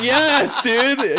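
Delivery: high, loud delighted yes; excited reply. Retold or imitated. Talking to a new skater friend, high and loud excitement. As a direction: cheer it like you found your people. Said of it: "I was like".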